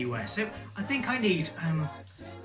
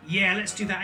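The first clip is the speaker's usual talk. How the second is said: normal talking voice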